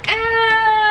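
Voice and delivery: sharp cry of pain; pained yelp. Real, not acted. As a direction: yelp after banging a knee. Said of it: "Damn, what you do?".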